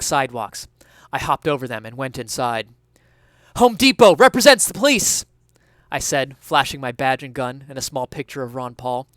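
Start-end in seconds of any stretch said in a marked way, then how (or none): 3.5-5.2 shouting cop. Acted. Detective bursts into office building.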